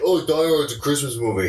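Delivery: ordinary delivery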